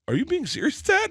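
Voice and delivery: incredulous high-pitched question; shocked disbelief. Retold or imitated. Son asking his dad if he is serious, in shocked high disbelief. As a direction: voice shoots way up, rising to a squeak on Dad. Retold shock at dad's theory.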